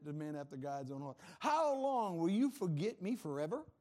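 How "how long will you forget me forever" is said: higher, loud, drawn-out lament; David crying out. Acted. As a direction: plead forcefully with God as the psalmist. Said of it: "Can you imagine David saying that".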